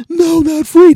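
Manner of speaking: shrieking, very high and loud; panicked kid shriek. Retold or imitated. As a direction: squeal in horror like a kid. Kids panicking.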